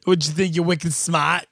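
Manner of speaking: put-on cocky Boston accent. Riffing to crack up co-host, Boston accent. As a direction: challenge them like a Bostonian. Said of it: "so good at a Boston accent".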